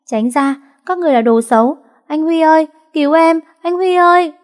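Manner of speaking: put-on high, loud panicked child's scream; screaming for help. A narrator's reading. Girl struggling against kidnappers, screams high and loud for help. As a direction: scream for her brother in terror. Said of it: "cô bé giãy giụa la hét".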